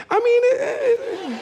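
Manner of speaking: squeaky-high, hesitant mock answer; voice up an octave. People asked if they like their job, voice up an octave. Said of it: "their voice goes up an octave".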